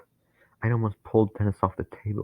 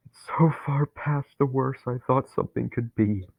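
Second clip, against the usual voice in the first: horrified; 5 semitones higher, 3 dB louder than usual. Acted. Dennis inside the center, horrified. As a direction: breathe out the horror.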